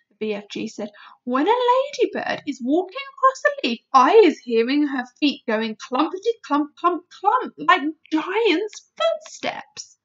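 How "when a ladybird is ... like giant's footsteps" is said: loud, high-swinging boastful giant; BFG. Acted. Giant boasts of hearing a ladybird, loud and animated. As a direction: relish every word as an eager giant.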